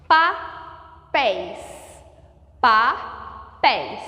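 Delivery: "Papéis" broken slowly into pa... péis; syllable by syllable. Shown as a demonstration. Teacher showing the stressed last syllable, slow and split. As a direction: sound out "Papéis" with a long pause between syllables. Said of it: "A última sílaba é tônica".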